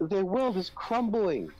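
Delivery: high, slow, drawn-out fake despair; mock tearful. Co-host mocking a news anchor's dismay, mock tearful. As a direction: imitate someone holding back tears. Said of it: "just trying to hold back the tears".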